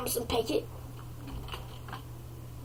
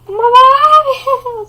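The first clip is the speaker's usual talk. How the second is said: put-on high, slow kid call to dad; little boy voice pitched up high; 8 semitones higher, 9 dB louder than usual. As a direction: play the child calling his father. Kid voice calls father.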